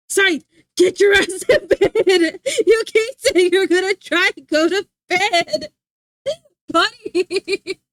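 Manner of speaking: loud, slow, high yell broken by laughs; a real shout across the room; laughing yell. Real, not acted. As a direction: holler at Scythe to get to bed, cracking up. Yelling at Scythe to sleep.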